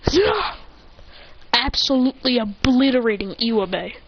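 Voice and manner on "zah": high, short "zah"; striking cry. Retold or imitated. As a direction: let out a final high attack yell. Imitated final strike.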